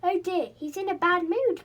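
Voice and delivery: high, loud worried call. Acted. Rabbit calling a warning to Silky, loud and high. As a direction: call out in alarm. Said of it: "called the rabbit".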